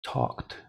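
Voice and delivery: "Talked" said softly as "tokt"; voiceless ending. Shown as a demonstration. Teacher confirming the answer, soft voiceless T ending. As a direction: end "Talked" on KT without voice. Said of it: "no tiene vibración".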